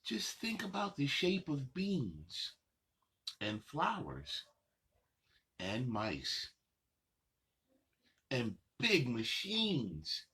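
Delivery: sing-song picture book reading, unhurried pauses; reading to children. Acted. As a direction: read the rhymes to little kids, savoring each line. Reads picture book aloud.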